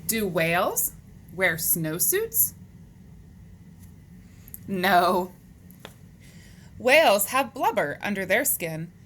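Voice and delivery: animated, measured picture-book reading; read-aloud to children. Acted. Teacher reading animal facts to boys and girls, animated read-aloud. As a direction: read it out to young kids, lifting the questions.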